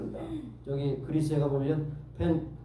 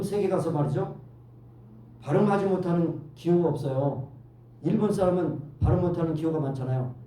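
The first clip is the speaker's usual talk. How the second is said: ordinary delivery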